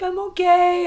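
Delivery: high, tearful little reassurance; smiling through tears. Retold or imitated. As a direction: imitate putting on a brave smile while crying. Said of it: "I'm trying to smile through it".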